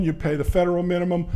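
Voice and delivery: ordinary talk, nothing marked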